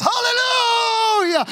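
very high, loud, slow cry of praise; wild shouted praise; ecstatic hallelujah. Retold or imitated. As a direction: explode with joy. Healed man praising wildly.

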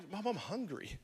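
put-on a child's plaintive whine, soft; child whining to mom; whiny kid. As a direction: complain like a hungry kid in church. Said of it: "My mom never made it to the early service".

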